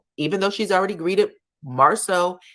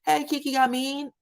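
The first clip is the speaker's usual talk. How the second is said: high, lilting mock greeting; imitating a teasing hello; playful name-teasing; 9 semitones higher than usual. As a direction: call out the nickname playfully. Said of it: "he's doing a play on their name".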